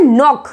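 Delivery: नॉक starting straight on n; silent k. Shown as a demonstration. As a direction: say नॉक as nock, no k. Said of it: "इसका right pronunciation है".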